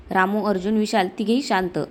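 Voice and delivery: usual speaking voice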